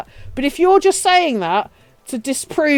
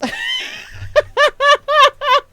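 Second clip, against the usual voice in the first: high laugh. Real, not acted. Laughs at mum's remark.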